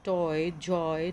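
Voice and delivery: "टॉय, जॉय" said slowly, each word drawn out to show the oi sound; slow clear example words. Shown as a demonstration. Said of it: "ये ओ और ई की sound देंगे". ("टॉय, जॉय" धीरे धीरे साफ़ बोलना)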